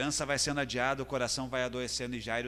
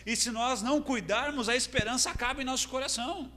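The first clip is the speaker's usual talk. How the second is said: higher, insistent sermon voice; impassioned preaching; 8 semitones higher, 3 dB louder than usual. Acted. Preacher applying Jairo's troubles to the listeners, impassioned. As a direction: press the point home to the congregation.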